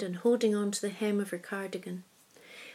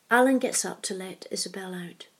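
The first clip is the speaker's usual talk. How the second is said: ordinary delivery, nothing marked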